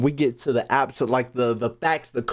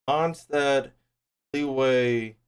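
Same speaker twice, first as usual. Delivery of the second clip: normal talking voice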